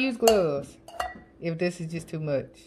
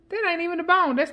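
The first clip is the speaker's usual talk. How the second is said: surprised correction; 9 semitones higher, faster than usual. Real, not acted. Realizes it's onion, amused.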